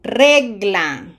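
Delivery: Fruta said loud and clear; Spanish pronunciation model. Shown as a demonstration. Teacher modelling a Spanish word loud and clear. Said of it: "we have to pronounce each vowel".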